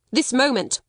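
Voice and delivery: fierce. A narrator's reading. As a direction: cut off any protest with a command. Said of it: "was the fierce answer".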